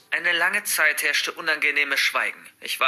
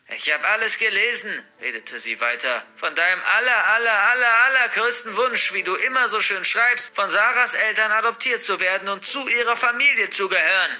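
put-on higher, louder spiteful old woman gloating; mocking grandmother; 6 semitones higher, 4 dB louder than usual. Grandmother mocking the girl's diary wish, gloating. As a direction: savour every cruel word.

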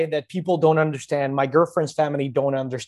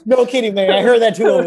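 laughing; 9 semitones higher, 4 dB louder than usual. Real, not acted. Laughing after the anal joke.

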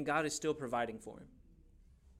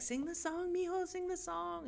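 high, thin put-on cooing; imitated pleading auntie; coaxing relative; 4 dB quieter than usual. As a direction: coax a shy child in falsetto. Imitates relatives coaxing the shy child.

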